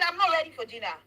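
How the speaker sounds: put-on child refusing dinner firmly. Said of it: "He said".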